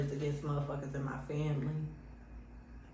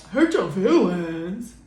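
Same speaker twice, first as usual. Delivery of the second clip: mocking disbelief; 6 dB louder, much slower than usual. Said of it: "they gonna be like".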